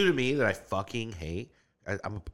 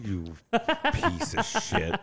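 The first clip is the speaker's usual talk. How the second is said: drawn-out, high, laughing jab; laughing insult; 9 semitones higher, 3 dB quieter, much slower than usual. Real, not acted. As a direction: tease him through a laugh, slowly. Mock insult over shirt, laughing.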